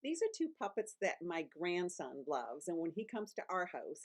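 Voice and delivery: ordinary delivery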